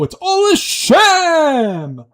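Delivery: drawn-out high exclaim of "sham"; slow, loud, theatrical cry; mock outrage. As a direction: declare it a sham like a hammed-up accuser. Mock outrage about fake accent.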